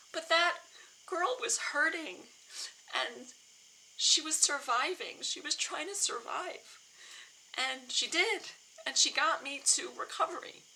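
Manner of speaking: high, slow, tearful telling; emotional. Real, not acted. Woman defending her younger self, genuinely emotional. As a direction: tell it slowly, holding back tears. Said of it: "I'm, like, getting emotional even talking about it now".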